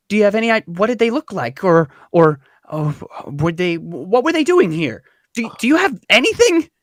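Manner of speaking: halting questions, rising high at the end; lightly voiced townswoman pressing for news; agitated questioning. GM as agitated Treese questioning investigators.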